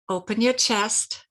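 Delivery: ordinary talk, nothing marked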